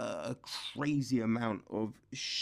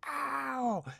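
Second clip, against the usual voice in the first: mock yelp of pain; 11 semitones higher than usual. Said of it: "make her like".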